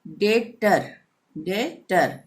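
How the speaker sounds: डेटर with no b before the t; silent B. Shown as a demonstration. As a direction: say डेटर twice in two clear syllables, डे and टर. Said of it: "बी का उच्चारण नहीं होगा".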